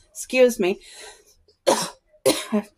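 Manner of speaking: coughing between words; dry throat, cough it out; coughing on dust. Real, not acted. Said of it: "felt like I swallowed dust".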